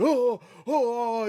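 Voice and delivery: high, loud mock groans of waking up; mock groaning. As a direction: ham up a pained waking groan. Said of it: "He's just going".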